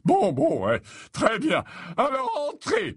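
high, loud fussy concession; giving in. Acted. Slughorn letting Harry and Ron in, relenting. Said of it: "en se laissant fléchir".

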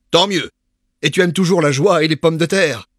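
put-on high, loud, boisterous old friend; a brash parvenu inviting his pal; jovial Patience. A narrator's reading. Patience's hearty questions. (ami bruyant et jovial)

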